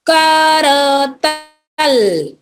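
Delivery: करतल stretched slowly, falling at the end; slow syllable reading. Shown as a demonstration. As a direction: drag out करतल for the kids to copy. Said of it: "तीन वाले शब्द देखते हैं". (करतल को धीरे खींचकर)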